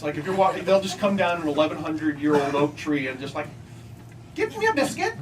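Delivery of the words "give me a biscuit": much higher squeaky squirrel. Retold or imitated. Comic voicing an English squirrel demanding a biscuit, high and squeaky. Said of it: "It has that accent".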